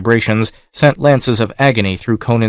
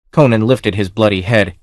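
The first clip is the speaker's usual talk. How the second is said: ordinary delivery, nothing marked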